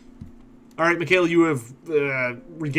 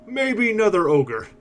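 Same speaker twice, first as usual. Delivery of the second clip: higher, slow musing ogre; 7 semitones higher, slower than usual. Acted. Ogre guessing who blocks the road, slow and musing. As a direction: ponder it slowly like a dim giant. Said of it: "scratches his chin for a moment and says".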